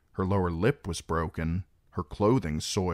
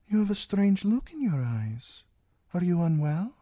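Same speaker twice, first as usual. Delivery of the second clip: concerned lady-in-waiting; 12 semitones higher than usual. A narrator's reading. Lady gently questions her troubled queen.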